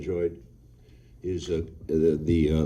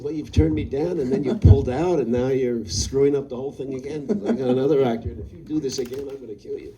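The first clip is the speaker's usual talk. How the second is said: half-laughing mock telling-off, higher and louder; mock angry threat; 4 semitones higher, 6 dB louder than usual. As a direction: scold him in jest, laughing through it. Retold threat to hesitant actor.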